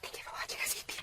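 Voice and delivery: soft unvoiced whisper into the mic; hushed ASMR thank-you to viewers. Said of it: "it was a whispering one".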